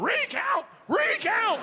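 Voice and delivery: very high, loud angry chant; drunk shouting. Retold or imitated. Drunk dads pound the table demanding a recount, shouting. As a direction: yell it like outraged drunk dads. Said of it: "pounding on the table going".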